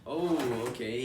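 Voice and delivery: usual speaking voice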